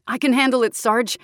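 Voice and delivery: cocky offer to the sergeant; eager young soldier. A narrator's reading. Young soldier volunteers to the Sarge, loud and eager. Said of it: "said the younger one next to him".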